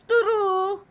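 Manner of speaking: high, sustained sing-out answer; drawn-out verdict. Pretend. Answering a personality quiz, high and drawn-out. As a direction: stretch the vowel on one held note.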